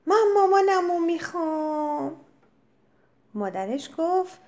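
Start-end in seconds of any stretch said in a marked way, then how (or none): 0.1-2.2 put-on high, loud scream in a little girl's voice; scared child screaming for mommy; frightened scream, higher pitch. A narrator's reading. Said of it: "جیغ زد".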